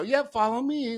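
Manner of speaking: put-on high, bright coaxing to a little kid; talking to a child. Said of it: "like a child".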